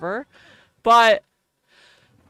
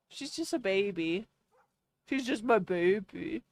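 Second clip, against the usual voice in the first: dog speak; 9 dB quieter than usual. Real, not acted. Talking sweetly to her dog, soft cooing baby talk. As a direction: coo at your puppy, much softer. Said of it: "That's how I talk to her".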